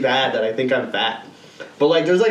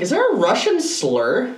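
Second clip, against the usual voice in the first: a hint of a naive ask; higher imitation of himself asking; mock curious question; 10 semitones higher than usual. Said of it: "I'm like".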